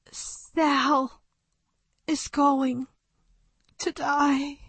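high, fading into a whisper. Acted. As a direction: let the thought die away. Thought fading out.

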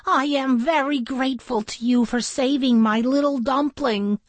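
high, fluttery motherly voice; grateful mother duck. A narrator's reading. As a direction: thank the rescuer effusively. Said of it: "the mother duck said".

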